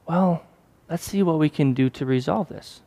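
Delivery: gently defuse an angry customer; calm reply. Retold or imitated.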